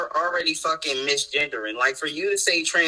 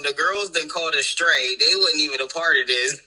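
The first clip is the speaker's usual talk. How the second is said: ordinary talk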